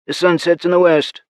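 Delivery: lightly voiced raspy soldier; crisp military radio report; raspy female soldier. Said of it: "her raspy voice".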